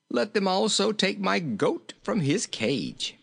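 high-pitched character voice; genial, high king; jolly little king. A narrator's reading. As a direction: give the request in a high, merry voice. Jolly king continues talking.

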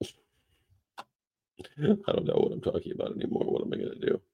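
breathy whispered aside. Real, not acted. Laughing to himself at rambling, whispered. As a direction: whisper it through the giggles.

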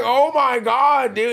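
high, slow, drawn-out awe; loud, high fan gasp of words; starstruck amazement. Retold or imitated. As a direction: stretch it out, overwhelmed by the moment. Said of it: "I'm like".

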